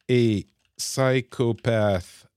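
slow, spelled-out English words as he types; dictating a prompt word by word; typing-along dictation. Real, not acted. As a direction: read each word out slowly while typing.

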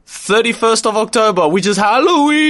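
high, loud spooky build; rising to a spooky shout. Pretend. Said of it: "the reason why we're talking like this".

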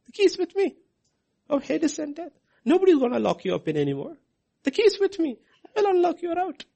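voicing Jesus. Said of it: "He says".

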